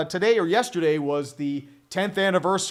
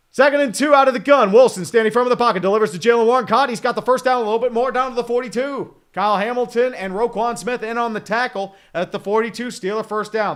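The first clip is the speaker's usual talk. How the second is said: loud, brisk broadcast call; play-by-play; 2 semitones higher, 5 dB louder than usual. Acted. Streamer calling his Steelers drive like a broadcaster, loud and brisk.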